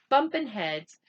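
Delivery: ordinary delivery, nothing marked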